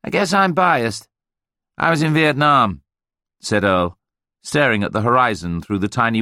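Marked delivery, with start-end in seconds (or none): 0.0-2.8 high, hearty American businessman; chummy American voice buttering up his seatmate; brash American. A narrator's reading. Earl's voice.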